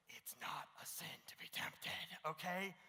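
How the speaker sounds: slow reassuring whisper; gentle whisper. Retold or imitated. Pastor reassures his friend in a slow, gentle whisper. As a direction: soothe him in a slow hush. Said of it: "I'm like".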